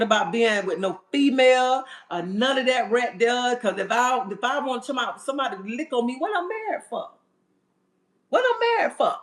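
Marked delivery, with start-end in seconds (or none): 6.2-9.2 high, sassy why-would-I; incredulous high rhetorical question; incredulous squeal. Real, not acted. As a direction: pitch shoots way up in disbelief. Rhetorical outburst.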